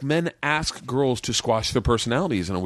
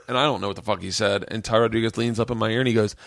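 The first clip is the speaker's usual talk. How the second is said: usual speaking voice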